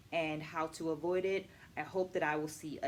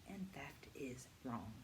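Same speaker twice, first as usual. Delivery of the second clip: soft whispered emphasis; 5 semitones lower, 14 dB quieter than usual. Real, not acted. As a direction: drop to a hushed whisper for the punchline. Dramatic point about plagiarism.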